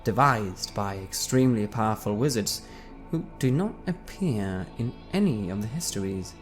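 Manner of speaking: ordinary delivery, nothing marked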